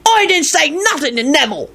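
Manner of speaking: high-pitched defensive character voice; protesting Mundungus. A narrator's reading. Mundungus breaking in to deny gossiping, defensive. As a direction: pipe up in indignant denial. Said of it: "Mundungus broke in quickly".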